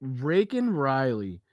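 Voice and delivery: Raken Riley slow and drawn out, with an R in place of the L; mocking mispronunciation. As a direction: stretch out Raken slowly, mocking the slip. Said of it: "it wasn't Raken".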